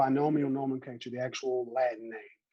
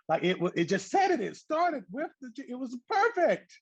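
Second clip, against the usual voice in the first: gushing; 10 semitones higher than usual. Real, not acted. Gushing over old name.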